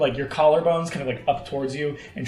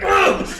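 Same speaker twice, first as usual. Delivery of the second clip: high, loud "ugh" of effort; sudden loud combat yell; charging grunt; 7 dB louder than usual. Acted. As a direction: grunt as you lunge in. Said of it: "he kinda, like, comes in, like".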